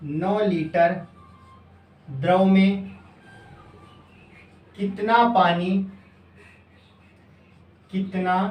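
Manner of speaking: slow, chunked dictation with repeats; teacher dictating a question slowly. Shown as a demonstration. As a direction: read it out bit by bit for students to copy.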